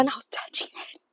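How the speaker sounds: hushed whisper of disgust; horrified whisper. Real, not acted. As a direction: whisper it, appalled. Horrified realisation.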